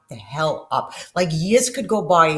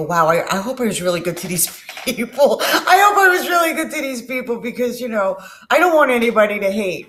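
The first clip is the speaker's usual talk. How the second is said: higher, louder, laughing through it; laughing wonder; 6 semitones higher, 6 dB louder than usual. Retold or imitated. Telling viewers how to react, laughing loudly. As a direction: marvel and laugh it off. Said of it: "look at it and go".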